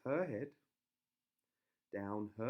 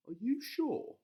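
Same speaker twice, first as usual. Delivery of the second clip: higher, doubtful husband; questioning husband voice; 13 semitones higher, 8 dB louder, slower than usual. A narrator's reading. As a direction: ask it doubtfully.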